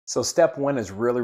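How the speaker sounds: usual speaking voice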